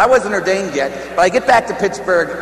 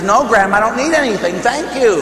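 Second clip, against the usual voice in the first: higher, louder protest to grandma; insistent refusal; 7 semitones higher, 4 dB louder than usual. Retold or imitated. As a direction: turn the money down more forcefully, pitch climbing. Refusing again as grandma pushes.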